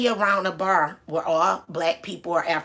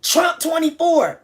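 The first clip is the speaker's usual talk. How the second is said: mock yell; 8 semitones higher, 5 dB louder, slower than usual. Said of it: "just scream out".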